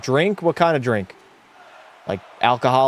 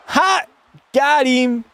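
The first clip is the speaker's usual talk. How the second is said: high, sudden triumphant burst; gotcha exclamation; 10 semitones higher, slower than usual. Pretend. Streamer springing a joke on chat, triumphant gotcha. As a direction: pop it out high as a prank lands.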